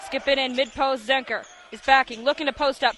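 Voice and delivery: ordinary talk, nothing marked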